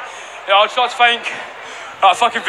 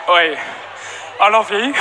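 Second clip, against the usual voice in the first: normal talking voice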